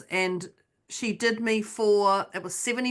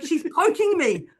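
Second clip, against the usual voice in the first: indignant inner voice; 8 semitones higher, 4 dB louder than usual. Retold or imitated. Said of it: "I was like".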